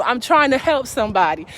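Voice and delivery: ordinary talk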